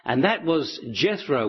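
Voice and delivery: plain reading voice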